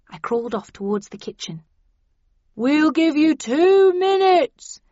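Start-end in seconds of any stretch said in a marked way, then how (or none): 2.5-4.8 loud, slow call through a megaphone; bullhorn voice. A narrator's reading. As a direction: boom it out over a bullhorn. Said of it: "said the voice into the bullhorn".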